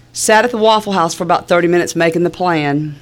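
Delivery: ordinary talk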